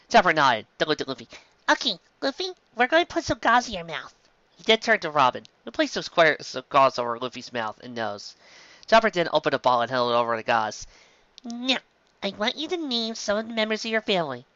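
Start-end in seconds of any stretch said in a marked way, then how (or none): 1.7-4.1 put-on high young reindeer doctor, earnest; high, brisk little doctor voice; Chopper. 11.4-14.4 put-on high young reindeer doctor, earnest; high, brisk little doctor voice; Chopper. Chopper gentle.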